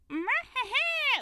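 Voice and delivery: high mock cackle; short, high put-on cackle; imitated cackle. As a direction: cackle like a cartoon figure. Imitates the figurine lady's cackle.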